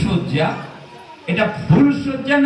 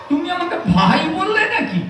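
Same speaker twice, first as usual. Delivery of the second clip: laughing incredulous question, pitched up; amused laugh; 8 semitones higher than usual. Acted. Husband laughing at his bride for calling him brother. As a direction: laugh it out in disbelief.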